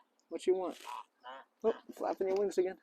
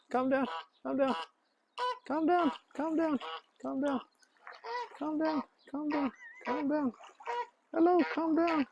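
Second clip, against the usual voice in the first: much higher, gentle repeated calm down; high soothing coo; 11 semitones higher, 3 dB quieter than usual. Real, not acted. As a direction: coax the bird like a pet. Soothing a frantic bird.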